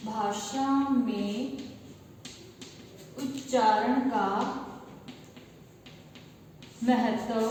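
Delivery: very slow, phrase-by-phrase dictation. Shown as a demonstration. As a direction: pause after each phrase so students can write. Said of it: "dictating slowly for note-taking".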